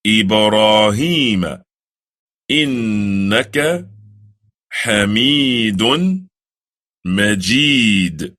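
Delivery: slow, measured Arabic recitation of the prayer. Shown as a demonstration. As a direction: recite it slowly, drawing out each word. Recites salawat slowly.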